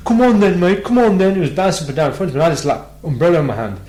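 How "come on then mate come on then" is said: high, loud goading yell; taunting shout. Retold or imitated. Ginger lad goading him in the street, loud taunting shout. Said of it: "He goes".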